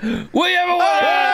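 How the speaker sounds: high, whooping winner announcement; mock-triumphant high shout; announcer's shout. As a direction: shout it like a game show host.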